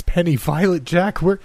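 high, frantic calling for family; terrified scream. Acted. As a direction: call out their names in terror. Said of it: "I screamed, terror in my voice".

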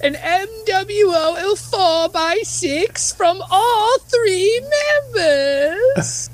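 high, slow prize announcement; announcer voice. Acted. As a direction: proclaim it like a game show host. Mock award announcement.

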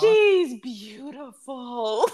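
put-on high, slow, awestruck child voice; little cousin's excited voice. Aunt retelling her little cousin seeing Ariel, high awestruck child. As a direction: gasp it out like a wide-eyed little boy. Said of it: "And she came out and he goes".

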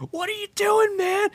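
high-pitched exasperated yelp; mock outrage. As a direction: demand it in hammed-up disbelief. Director confronts fleeing stuntman.

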